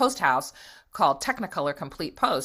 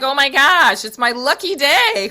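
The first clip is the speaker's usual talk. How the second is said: much higher and louder, gushing; giddy swooning; 9 semitones higher, 9 dB louder than usual. Retold or imitated. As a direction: swoon over the handsome stranger. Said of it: "I was like".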